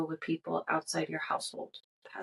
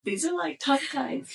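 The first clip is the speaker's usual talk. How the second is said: laughing; 8 semitones higher than usual. Real, not acted. Reading while overcome with giggles.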